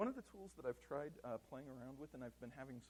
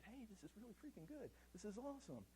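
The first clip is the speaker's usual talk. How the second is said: retold enthusiasm, lightly acted; mock excitement; 7 semitones higher, 4 dB quieter than usual. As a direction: imitate an excited team, pitched up without raising the volume. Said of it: "they were all excited".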